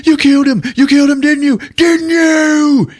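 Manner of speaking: high, loud hammy shouting; overacted accusation. Podcaster mocking an overacting merc, loud and hammy. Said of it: "Jason Fleming is like".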